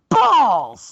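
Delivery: sudden high yelled "Balls!"; shouted catchphrase. Acted. Wrestler hollers his name for the camera, loud and high. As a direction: bellow it like a wrestler hungry for TV time.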